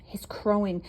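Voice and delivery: hushed sneering whisper. Acted. Gunther whispering his accusation to the crowd. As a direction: whisper it like gossip. Goose still telling secret.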